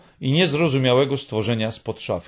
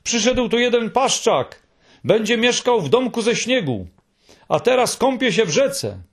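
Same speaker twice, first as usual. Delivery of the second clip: high excited shout of a name; breathless high call to a friend; 9 semitones higher than usual. A narrator's reading. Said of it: "krzyczał".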